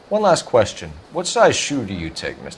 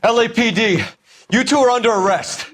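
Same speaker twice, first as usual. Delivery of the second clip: raised arrest shout; arrest call; 11 semitones higher than usual. Acted. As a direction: call out the arrest over the scuffle. Partner announces arrest.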